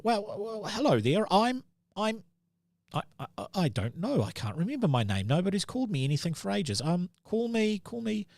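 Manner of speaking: put-on high, fast, dithering old gnome; a lost little gnome fumbling for his own name. GM plays the forgetful gnome.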